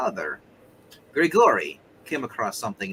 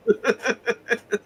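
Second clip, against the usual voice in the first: soft, high giggle; laugh; 8 dB quieter than usual. Real, not acted. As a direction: giggle quietly to yourself. Amused reaction during game chat.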